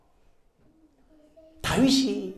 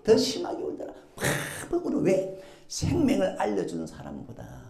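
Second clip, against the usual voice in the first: ordinary talk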